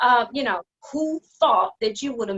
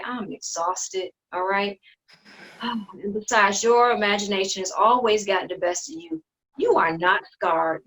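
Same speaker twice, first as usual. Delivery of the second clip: ordinary talk, nothing marked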